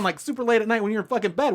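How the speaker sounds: normal talking voice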